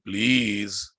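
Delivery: usual speaking voice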